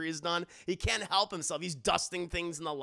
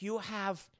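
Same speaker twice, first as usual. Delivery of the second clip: put-on German-accented therapist, slow and probing; softly leading questions in a German accent; mock German doctor, trailing down softer; Hugo Strange; 3 dB quieter than usual. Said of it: "a very thick German accent".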